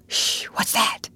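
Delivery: breathy, alert hush; whisper. A narrator's reading. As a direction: hush your friend and listen. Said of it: "he whispered".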